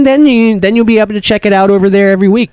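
high, upbeat announcer voice. Acted. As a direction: sell the channel plug. Channel plug.

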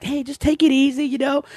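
high, mild imitation of the trainer calming him; cab trainer. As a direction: pitch it up, soothing the reckless driver. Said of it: "the trainer's like".

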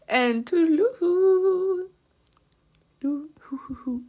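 high, slow, hammed-up toodaloo; silly sing-song goodbye. Podcast host signs off to listeners, hammed-up high sing-song. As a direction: play it up like a goofy cartoon farewell. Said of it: "I'm fucking hilarious".

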